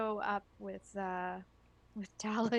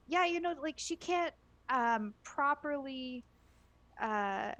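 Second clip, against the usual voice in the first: high wheedling voice; 10 semitones higher than usual. Acted. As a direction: sweet-talk the ogre. Character persuading ettin, high voice.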